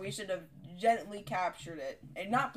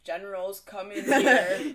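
mock announcement; 8 dB louder, much slower than usual. Mock sign reading.

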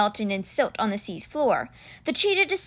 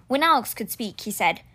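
ordinary delivery

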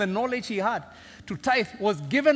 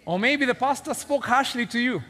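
ordinary talk

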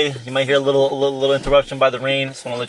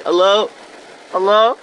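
higher-pitched, searching call into a house; retold calling out; 6 semitones higher, 4 dB louder than usual. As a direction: imitate yourself calling out for anyone there. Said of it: "I was like that".

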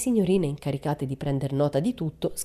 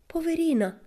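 moved; 14 semitones higher than usual. A narrator's reading. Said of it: "si commosse Pippi".

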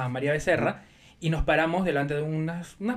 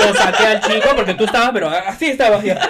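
loud laughing tease, higher; 4 semitones higher, 12 dB louder, slower than usual. Real, not acted. Teasing her friend about the fight, laughing loudly. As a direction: laugh through the words.

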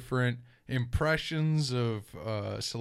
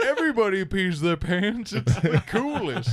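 raised voice, laughing at the end; Billy Madison quote; 7 semitones higher, 4 dB louder than usual. Retold or imitated. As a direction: quote it like a proud goofy kid. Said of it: "where he's like".

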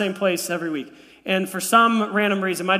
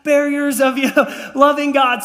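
higher-pitched, drawn-out playful celebration; mock triumph; 5 semitones higher than usual. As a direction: celebrate like a proud dad, half laughing. Acts out his delighted reaction to daughter.

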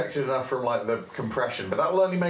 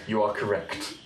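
mock robot voice; 4 semitones lower than usual. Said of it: "That was you saying".

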